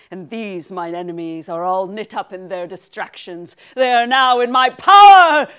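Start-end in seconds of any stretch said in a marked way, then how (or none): 3.8-5.5 triumphant. Acted. Prospero triumphant.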